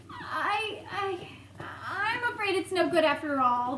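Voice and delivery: ordinary delivery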